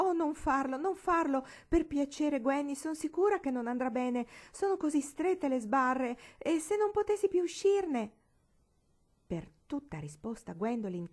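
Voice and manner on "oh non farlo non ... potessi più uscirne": high, loud anxious begging; pleading. A narrator's reading. Marigold begs Gwendolyn not to do it, high and anxious. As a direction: beg her to stop, rising in alarm. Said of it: "che supplicava".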